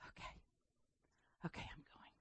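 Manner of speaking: hushed, defeated okay okay; reluctant whisper. Retold or imitated. Jonah grudgingly answering God, whispered. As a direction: whisper it like giving in. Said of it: "he says".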